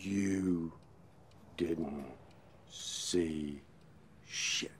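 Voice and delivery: husband repeating a threat to his wife, low and slow; low menacing threat. Acted.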